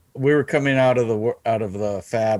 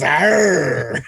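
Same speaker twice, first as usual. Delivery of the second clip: pirate growl; 3 semitones higher than usual. Retold or imitated. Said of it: "he looked at me and goes".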